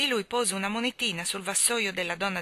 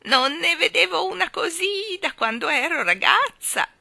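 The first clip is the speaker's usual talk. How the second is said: put-on trembling old woman; 11 semitones higher, 9 dB louder than usual. Balloon woman chides the children, in a loud trembling old voice. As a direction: scold them like a frail old woman full of passion.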